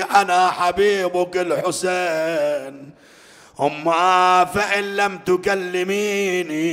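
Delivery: loud, high, grieving sermon chant; mourning lament preaching. Acted. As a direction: wail the story to the mourners. Lament recitation over music. (نعي حسيني بصوت عالٍ ونبرة باكية)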